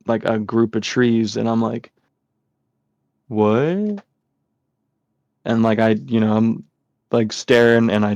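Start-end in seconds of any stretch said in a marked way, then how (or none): 3.3-4.0 rising, drawn-out disbelief; baffled question. Retold or imitated. Kid spotting a figure behind trees, baffled. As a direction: imitate a puzzled "what", pitch sliding up.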